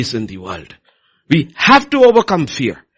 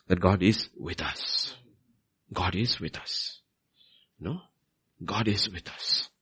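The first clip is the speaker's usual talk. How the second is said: soft repetition; 8 semitones lower, 12 dB quieter, slower than usual. Real, not acted. Preacher to congregation, hushed and slow. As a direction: drop to a hushed, slow repeat.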